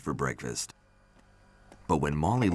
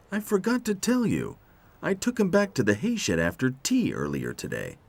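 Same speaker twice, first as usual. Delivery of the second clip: lightly voiced higher character; confessing Roy; 10 semitones higher than usual. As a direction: admit it in a slightly acted voice.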